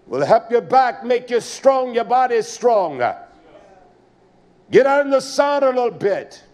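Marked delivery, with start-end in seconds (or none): none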